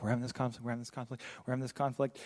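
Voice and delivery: low, soft repetitive grumble; workplace bickering. Acted. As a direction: act out a hushed office squabble. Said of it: "it's like".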